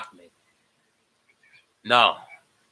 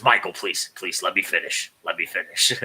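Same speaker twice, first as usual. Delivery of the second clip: low put-on voice, begging to talk; imitated pleading; 6 semitones lower than usual. Host mimicking someone pleading with Michael, low put-on plea. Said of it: "I goes".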